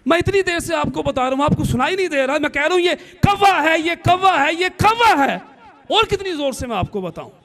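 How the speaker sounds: building from irritation to a yell; angry son. Retold or imitated. Grown son yelling at his deaf mother about the crow.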